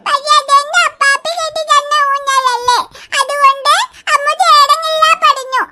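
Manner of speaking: ordinary delivery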